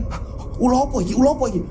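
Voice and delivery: fast, high startled cry; panicked at the sight of the snake; frightened. Acted. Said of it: "bapaknya ketakutan kan". (ketakutan, cepat dan tinggi)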